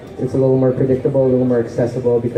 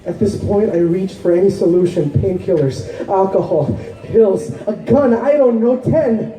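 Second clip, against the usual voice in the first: higher-pitched, urgent poetry recital; impassioned spoken-word poem; 10 semitones higher than usual. Acted. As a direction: pour out the heartbreak as slam poetry. Poem at its emotional height.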